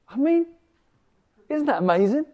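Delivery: high, loud burst of excitement; excited exclaim. Real, not acted. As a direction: exclaim in amazement. Sudden excitement.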